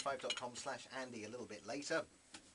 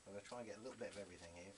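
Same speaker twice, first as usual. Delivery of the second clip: ordinary delivery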